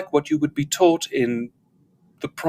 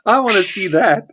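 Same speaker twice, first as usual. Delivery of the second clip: high, louder, just out of laughter; delighted, raised voice; amused excitement; 7 semitones higher, 5 dB louder than usual. Real, not acted. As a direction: blurt it out after a big laugh. Reacting after a long laughing fit.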